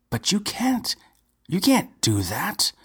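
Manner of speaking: high, frightened protest; terrified exclaim. A narrator's reading. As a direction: plead in sudden terror, voice jumping up. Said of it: "exclaimed the young man in terror".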